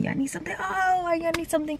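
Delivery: high, soft mock inquiry; mimicked question. Coworkers asking as I come in, high soft mimicry. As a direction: imitate them asking, light and high.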